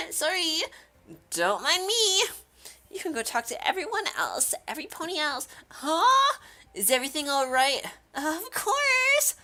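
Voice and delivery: high-pitched apologetic character voice; cutesy high anime-style voice; timid game character. Acted. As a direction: lift it high and sweet, brushing off concern.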